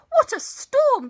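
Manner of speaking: very high, loud bird voice; twittering wren. A narrator's reading. Wren flutters in from the storm, high loud twitter. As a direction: twitter it loudly like an excited little bird. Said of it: "twitters wren".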